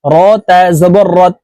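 ordinary talk

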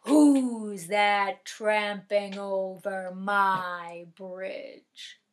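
slow, low, looming troll growl; menacing troll. Acted. Troll to the biggest billy goat, slow and menacing. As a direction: drag each word out like a monster under the bridge. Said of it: "The terrible troll ... and said".

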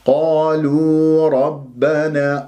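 slow recitation with heavy ر in ربنا; measured recitation. Shown as a demonstration. Teacher modelling the verse for students, slow chanted recitation. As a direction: stretch قالوا, keep the ر full and open. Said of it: "لا، را مفخمة لأنها مفتوحة".

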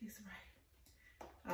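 breathy whisper under her breath. Real, not acted. Vlogger cutting the package open, whispering to herself. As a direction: whisper it while cutting carefully.

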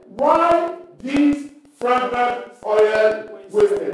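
very slow drawn-out indignant question; slow, drawn-out mock outrage. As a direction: stretch the complaint out slowly. Voicing indignant disciples.

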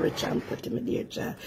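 breathy, soft whisper of affection; whispered aside. Real, not acted. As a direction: whisper it softly to the viewers. Cooking vlog, fond aside to viewers.

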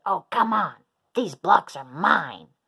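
loud protest, slowing to insist; exasperated shout. A narrator's reading. Minosaur arguing over blocks with classmates, shouting. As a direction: argue it loudly, landing hard on the end.

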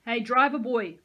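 loud mocking call across; taunting call. A narrator's reading. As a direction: call him out with a sneer. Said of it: "Peyton called to Nico".

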